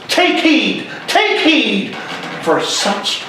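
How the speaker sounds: slow, high-pitched theatrical Shakespearean declamation; impassioned stage friar, drawn-out phrases rising in pitch; stern Friar Lawrence. Acted. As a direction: scold a despairing young man, then counsel him, in measured stage verse. Acts Friar Lawrence's rebuke of Romeo.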